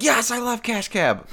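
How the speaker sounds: high, slow gushing fan; buddy's excited voice. Retold or imitated. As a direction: gush like an eager fan. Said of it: "he was like".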